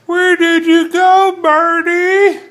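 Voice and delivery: high, loud, slow bird call; coaxing a wild bird. Real, not acted. Calling out to a bird.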